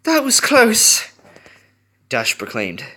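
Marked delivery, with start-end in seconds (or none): 0.0-1.1 breathless. A narrator's reading. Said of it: "still breathing heavily".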